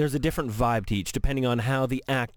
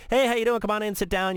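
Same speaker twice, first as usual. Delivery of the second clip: bright, higher, friendly greeting; cheerful welcome; 7 semitones higher than usual. Retold or imitated. Reporter voicing a playful star's welcoming room, upbeat. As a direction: welcome them in like a happy host. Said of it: "you feel".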